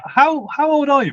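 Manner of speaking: sudden curious surprise; surprised question. Real, not acted. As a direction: pitch jumps way up in surprise. Suddenly curious about his age.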